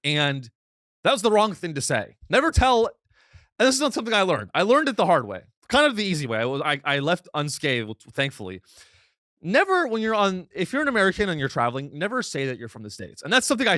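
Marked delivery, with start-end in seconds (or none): 2.3-2.9 NEVER pushed higher and louder; emphatic warning. Real, not acted. Warning American travellers, much higher and louder on Never. 9.4-10.5 NEVER pushed higher and louder; emphatic warning. Real, not acted. Warning American travellers, much higher and louder on Never.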